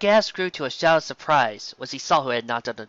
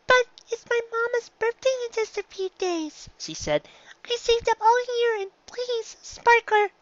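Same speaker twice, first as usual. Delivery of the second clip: put-on much higher child's voice, slow and begging; a little filly begging for her mama's gift; pleading filly. A narrator's reading.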